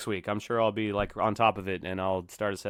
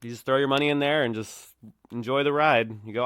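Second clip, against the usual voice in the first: usual speaking voice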